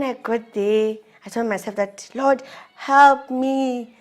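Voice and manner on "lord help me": drawn-out, slow plea to God, a bit higher and louder; slow prayer. Retold or imitated. Wife retelling her prayer, slow pleading to the Lord. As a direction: stretch each word out like a whispered-to-God prayer. Praying in retold inner speech.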